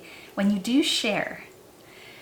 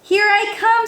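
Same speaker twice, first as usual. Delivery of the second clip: happy call; 9 semitones higher, 7 dB louder than usual. A narrator's reading. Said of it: "said rainbow fish".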